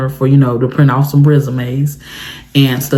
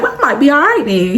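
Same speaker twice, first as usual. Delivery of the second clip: surprised relief; 10 semitones higher than usual. Retold or imitated. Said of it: "I was like".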